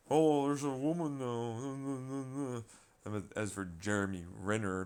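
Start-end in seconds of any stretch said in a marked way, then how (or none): 0.1-2.6 slow, drawn-out sneering mimicry; sarcastic mock quote. Mocking the co-stars, slow and sneering. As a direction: stretch it out like a mocking impression.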